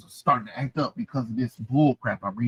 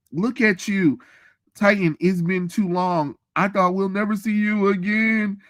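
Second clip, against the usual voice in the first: put-on a lifted, higher queen voice greeting an old friend; regal greeting voice; 8 semitones higher than usual. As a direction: greet her warmly as a queen, pitched well up.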